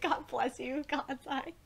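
ordinary delivery

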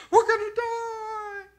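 high, loud, slow crying wail of panic; panicked wail. Retold or imitated. Grabbing Jesus in the boat, panicked crying wail. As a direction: wail it like a terrified man in a storm. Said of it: "shake him and go".

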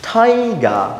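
"টাইগার" as high, drawn-out "thai-gaa"; silent final R. Shown as a demonstration. Teacher explains the silent R, "টাইগার" ending in a long aa. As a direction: aspirate the T and leave "টাইগার" open at the end. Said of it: "আরটা silent হয়ে যায়".

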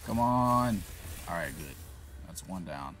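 ordinary talk, nothing marked

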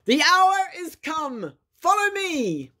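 high, slow battle call; rally the comrades to charge; rousing cry. A narrator's reading. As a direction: Badger calling his comrades into battle.

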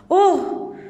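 put-on boy begs the convict not to kill him; pleading in terror. A narrator's reading. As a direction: beg for your life like a terrified child. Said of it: "I pleaded in terror".

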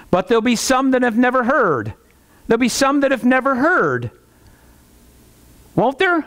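high-pitched mock argument; imitated objector. Preacher voicing the argument about those who never heard, high and insistent. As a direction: raise the pitch as if pressing an objection. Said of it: "only people I ever hear make that argument".

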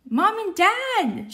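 high, loud, drawn-out cry of delight; joyful shout. Acted. Luna finds her parents aboard the ship, high happy shout. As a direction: call out to long-lost parents. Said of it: "shouts Luna".

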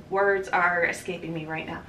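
ordinary talk

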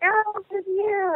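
put-on high, slow small-kid voice; lightly voiced sweet little boy. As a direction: imitate a shy little boy asking sweetly. Little boy's quote, high and slow.

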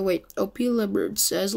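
usual speaking voice

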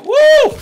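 sudden high yelp of surprise; startled high yelp. Real, not acted. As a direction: yelp as if jumped at. Sudden scare in game.

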